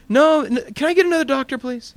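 high, alarmed begging for another doctor; mock-panicked squeal of a patient; panicked plea. Patient panics at eye-poking doctor.

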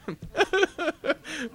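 a high comic laugh before Frank pleads; high mock laugh. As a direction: laugh it off in character.

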